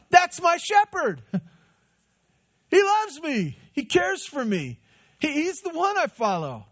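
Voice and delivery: a thrilled sheep who adores its shepherd; a sheep happily recognizing its shepherd's voice; delighted sheep. Pretend.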